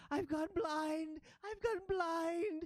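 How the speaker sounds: high, drawn-out wail; panicked ratling. Acted. Blinded ratling wakes and finds she can't see, panicked wail. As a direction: cry out in shrill-pitched panic, stretching the words.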